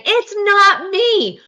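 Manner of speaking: very high, loud, drawn-out mock yell; hammed-up shrieked protest; mock scream. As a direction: imitate a screamed denial, stretching each word. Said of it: "Lisa screams again".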